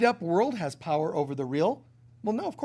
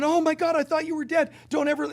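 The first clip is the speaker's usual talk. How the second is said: father crying out, much higher and louder, stunned to see his son; shocked joy; 11 semitones higher, 5 dB louder, faster than usual. Acted. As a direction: gasp it out like you've seen a ghost come home. Father finds lost son.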